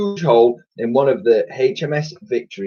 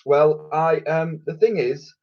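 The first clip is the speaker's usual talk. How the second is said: hesitant; 7 semitones higher than usual. A narrator's reading. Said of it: "he hesitated".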